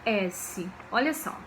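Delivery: usual speaking voice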